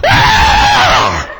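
loud, drawn-out holler, as if struck; imitated ecstatic scream. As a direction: imitate a woman shouting in church. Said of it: "Holy Ghost hit her and she went".

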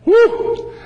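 preacher's happy high whoop after the point; excited whoop. Real, not acted. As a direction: let out a joyful whoop.

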